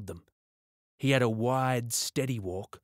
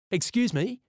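put-on much higher child's voice; boy piping up; 11 semitones higher, 4 dB louder than usual. A narrator's reading. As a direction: pipe up politely like a kid from the back seat. Boy pipes up to officer.

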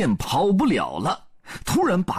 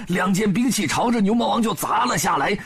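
ordinary delivery

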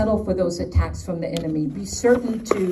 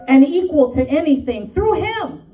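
New York attitude; 6 semitones higher, 4 dB louder than usual. Shown as a demonstration. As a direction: punch each phrase with New York swagger. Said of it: "say it with my New York attitude".

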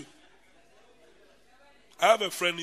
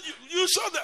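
laughing; 10 semitones higher than usual. Retold or imitated. Sister calls brother, laughing.

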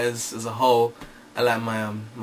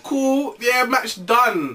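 much higher, slow breezy shrug; mock casual; 8 semitones higher, much slower than usual. Mock nonchalance after losing an arm, higher. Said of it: "I'm like".

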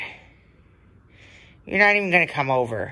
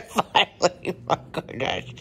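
laughing with relief. Real, not acted. Pet finally arrives.

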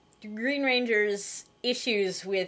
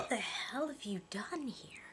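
whispered disbelief; hushed aside; 2 semitones higher, 12 dB quieter than usual. Real, not acted. Co-host questioning a setup, hushed aside. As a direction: hiss it under your breath.

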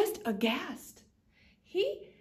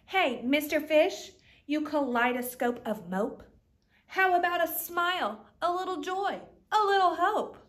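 louder, higher, urging squid; pushy squid; 4 semitones higher, 7 dB louder than usual. Acted. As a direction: badger him into a smile. Squid urges fish to smile.

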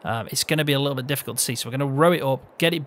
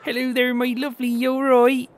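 put-on high, loud West Country farmer accent; farming accent; 11 semitones higher, 5 dB louder than usual. Host demonstrates his new farmhand character, high farming accent. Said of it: "That kind of style accent".

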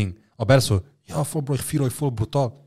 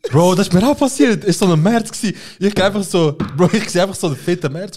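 excited; 7 semitones higher, 6 dB louder than usual. Real, not acted. Excited retelling.

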